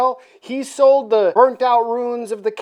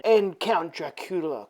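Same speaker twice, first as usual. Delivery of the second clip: ordinary delivery, nothing marked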